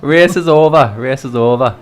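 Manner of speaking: playful mock-Welsh catchphrase; cheerful attempt at a Welsh accent; mimicking a Welsh accent. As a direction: repeat it in a put-on Welsh accent. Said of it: "I can't even do a Welsh accent".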